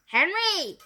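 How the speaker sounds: loud high shout of his name; teacher shouting at Henry. A narrator's reading. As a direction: yell at the troublemaker. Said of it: "shouted Miss Batlax".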